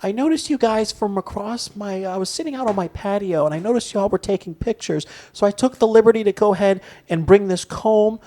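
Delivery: lightly voiced sweet old lady; a hint of a sweet old lady. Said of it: "in that, you know, old lady voice".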